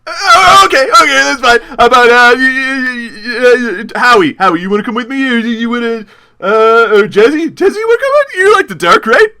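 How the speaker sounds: high, flustered, stuttering plea; nervous stammering. Acted. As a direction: scramble anxiously for someone to come along. Rebuffed, nervously seeking company.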